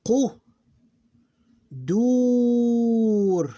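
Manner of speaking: "qu, dur" read high and loud, "dur" held long; teacher demonstrating a two-syllable word aloud; drawn-out Arabic syllables. Shown as a demonstration. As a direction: stretch "dur" like a reciter. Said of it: "Here this word is".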